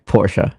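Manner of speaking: Porsche in two syllables, final E sounded; copied German pronunciation. Shown as a demonstration. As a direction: repeat Porsche as "POR-shuh". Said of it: "It's Porsche".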